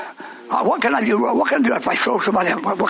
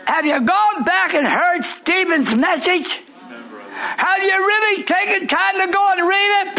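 full-force preaching; 8 semitones higher, slower than usual. Real, not acted. Preacher challenging his congregation about Stephen, fervent preaching. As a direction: drive the story home to the flock.

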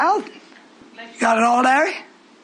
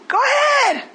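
go ahead; 3 dB louder, slower than usual. Real, not acted. Preacher dismissing the congregation.

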